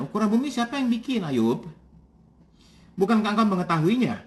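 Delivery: quick, pointed sarcastic question. Acted. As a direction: needle him with mock innocence. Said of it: "Nah, sarkastik". (pertanyaan sinis yang cepat)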